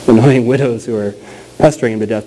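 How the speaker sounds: ordinary talk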